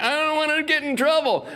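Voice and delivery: high, meek little voice; timid self. Retold or imitated. Cornered comic at the party, high frightened squeak. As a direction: squeak it out like a scared kid. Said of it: "I'm like".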